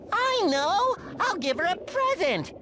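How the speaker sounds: high-pitched burst of a happy idea; excited brainwave. Acted. SpongeBob planning a thank-you present for Sandy, excited. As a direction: exclaim it high, thrilled with the idea.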